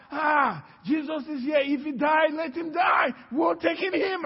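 high, forceful acted protest; urgent insistence. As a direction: brush off the objection with urgency.

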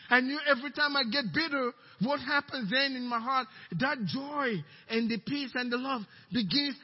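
usual speaking voice

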